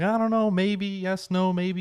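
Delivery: high, wavering-between-answers quote; mock indecision. Acting out indecision about party.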